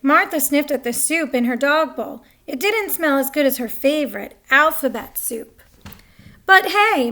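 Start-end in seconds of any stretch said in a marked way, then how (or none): none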